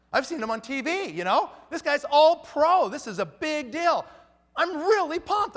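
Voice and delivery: hyped about meeting a big star; retold excitement. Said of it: "I'm really excited about this".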